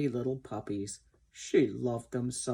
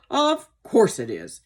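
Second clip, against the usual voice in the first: loud, high, indignant agreement; angry old horse; 5 semitones higher, 8 dB louder than usual. A narrator's reading. Sir Oliver answers Ginger about fashion, angry. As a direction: agree hotly, pitched up. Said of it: "all this made him very angry".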